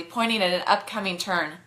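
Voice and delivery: ordinary delivery, nothing marked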